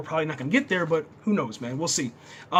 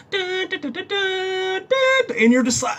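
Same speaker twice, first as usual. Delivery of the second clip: high sung-out fanfare "duns"; imitated theme music; 13 semitones higher, 4 dB louder, slower than usual. Fan imitating the music as the hero lands, high drawn-out duns. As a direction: belt out a heroic sting on "dun". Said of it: "You hear the music".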